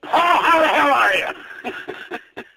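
ordinary talk

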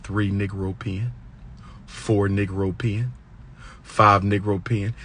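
low, slow, hushed count; soft, deliberate counting under a steady stare; counting to oneself. Shown as a demonstration. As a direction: count silently to yourself, barely voiced aloud. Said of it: "Count to yourself".

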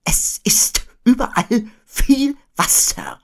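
high, slow, airy owl voice; Eule. A narrator's reading. Owl explaining to the boy, high, airy and slow. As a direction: draw it out high with plenty of air. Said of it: "erläuterte Eule".

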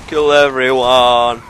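slow, loud, mock-menacing line; mock villain. Gamer plays a slow, loud mock villain to viewers. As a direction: ham up a killer's glee.

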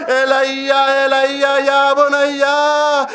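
high, very slow keening call; wailing lament. Acted. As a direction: stretch the call out like a grieving father. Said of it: "اسی لاش سے آواز آئی".

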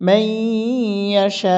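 মাই held nasally, ইয়াশা drawn long and then stopped; stopped hamza ending. Shown as a demonstration. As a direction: stretch ইয়াশা and cut it off on a hamza. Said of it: "এভাবে একটা ঠোঁট দিয়ে থামবেন".

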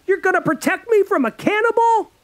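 high, loud scornful yell; girl yelling in disbelief; furious mocking shout. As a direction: throw his plan back in his face.

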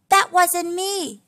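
high, loud, slow little piglet protest; a child warthog insisting it wasn't him; Baby Warthog. Acted. Baby Warthog denying the burp to his mother, high, loud and slow.

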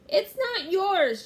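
high, loud, drawn-out protest; defiant shout. A narrator's reading. Annie protesting to the pirate captain, high shout. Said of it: "shouted Annie".

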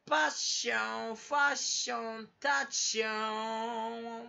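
drawn-out words with a ringing, held tail; imitating lingering reverb. Rhyming words to viewers as a reverb impression, echoing drawn-out delivery. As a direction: stretch each word like a fading echo. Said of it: "今余韻のモノマネね".

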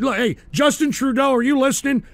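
normal talking voice

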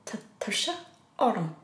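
tired whisper. Shown as a demonstration. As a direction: let it sag out on a tired breath. Acting out tiredness while teaching.

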